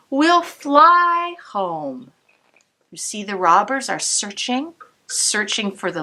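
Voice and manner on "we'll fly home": tender promise. Acted. Grandmother's hopeful promise.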